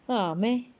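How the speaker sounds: ordinary talk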